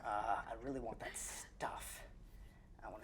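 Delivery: breathy whispered word; hushed whisper. Real, not acted. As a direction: whisper it under your breath.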